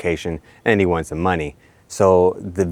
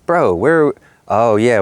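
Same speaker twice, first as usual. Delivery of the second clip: louder, higher mock exasperation; exasperated; 5 semitones higher, 5 dB louder, slower than usual. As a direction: call out in disbelief. Mock address to sun.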